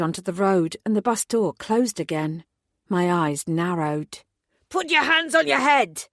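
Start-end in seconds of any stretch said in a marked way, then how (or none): 4.7-6.1 high, loud barked order; officer shouting. A narrator's reading. Armed officer ordering the tourist, shouting. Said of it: "shouted the same officer".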